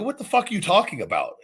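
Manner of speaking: retold disbelief. Wrestler answering news that the boss wants trunks, incredulous. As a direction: push the question up high in mild mock outrage. Retells his disbelieving reply backstage.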